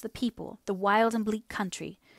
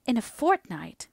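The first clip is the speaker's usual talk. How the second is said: stunned; 4 semitones higher, 4 dB louder, slower than usual. Acted. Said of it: "Margaret was almost stunned".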